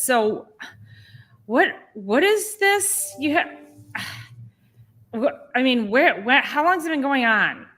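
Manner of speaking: the patient mocks the doctor's blunt questions; curt doctor. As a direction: lightly imitate a dismissive doctor. Voicing curt doctor, retold.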